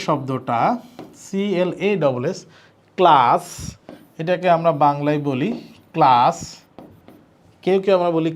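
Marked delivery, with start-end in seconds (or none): 3.0-3.4 ক্লাস ending in a hissed English s; louder, sharp s at the end of ক্লাস; English s sound. Shown as a demonstration. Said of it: "ইংরেজি এস এর মতো". 5.9-6.4 ক্লাস ending in a hissed English s; louder, sharp s at the end of ক্লাস; English s sound. Shown as a demonstration. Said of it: "ইংরেজি এস এর মতো".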